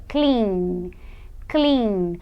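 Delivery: drawn-out "clean", humming on the N; voiced final sound. Shown as a demonstration. Teacher has learners feel their throat, stretched "clean" with buzzing N. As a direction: hold the N of "clean" so it vibrates. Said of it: "Coloca a mão na sua garganta e fala".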